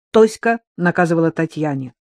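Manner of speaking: ordinary delivery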